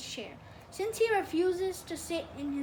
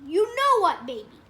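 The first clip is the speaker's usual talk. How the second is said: much louder, higher insistence; exasperated; 4 semitones higher, 11 dB louder than usual. A narrator's reading. Father to stubborn son about the baby, exasperated and loud. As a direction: come down hard on the middle word.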